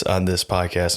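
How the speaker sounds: ordinary talk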